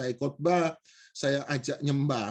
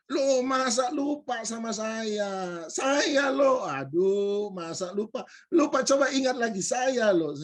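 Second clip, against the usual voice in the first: higher, drawn-out mock disbelief; incredulous insistence; 5 semitones higher, slower than usual. Stranger insisting the speaker remember him, incredulous. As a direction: imitate an over-familiar acquaintance insisting.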